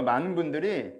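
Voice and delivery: ordinary talk, nothing marked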